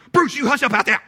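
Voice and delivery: high, loud burst of a command; dog-scolding yell. Shown as a demonstration. Owner to dog barking under the window, scolding yell. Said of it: "you gotta say something like".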